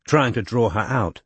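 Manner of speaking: ordinary delivery, nothing marked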